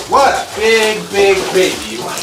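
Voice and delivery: normal talking voice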